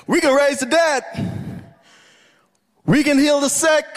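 high, fervent boast; acted disciple full of bravado; eager follower. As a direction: proclaim it high and zealous. Voices an eager follower of Jesus.

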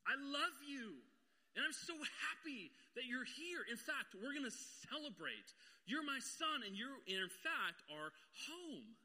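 mock-exultant father welcoming his son; lifted, happy father's voice; joyful father. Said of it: "Here's what the father says".

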